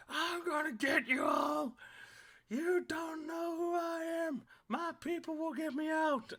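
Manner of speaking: high-pitched, loud screamed threats; screaming. Acted. Prisoner screaming threats from inside, high loud shouting. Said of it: "the guy still screaming".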